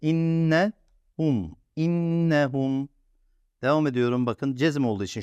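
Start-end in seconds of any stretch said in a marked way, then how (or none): 0.0-2.9 "İnnehum" slowly, holding the double n; slow syllables. Shown as a demonstration. Teacher slowly sounding out "İnnehum" for the class. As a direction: drag out each syllable of "İnnehum". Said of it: "şu ikisi birlikte".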